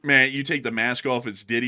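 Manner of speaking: normal talking voice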